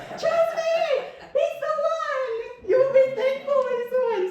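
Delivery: hammed-up high-pitched holler; mock screaming back. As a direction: imitate husband hollering reassurance. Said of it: "I was screaming".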